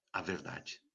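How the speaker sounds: near whisper. Acted. Preacher to listeners, repeating in a near whisper.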